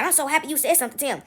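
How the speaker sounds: softly voiced grateful bystander. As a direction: say it softly, as a private thanks. Retold confiding bystander.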